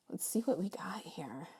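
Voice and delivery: hushed whisper to herself. Real, not acted. Tarot reader whispering over her cards, hushed whisper. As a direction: murmur it unvoiced while looking at the cards.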